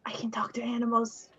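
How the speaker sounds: soft hushed whisper. Real, not acted. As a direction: keep it under your breath. Hushed reply beside the rat.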